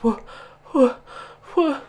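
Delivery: broken, repeated gasped syllables; stammer, too scared to finish; terrified stutter. A narrator's reading. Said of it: "Pog could only stutter".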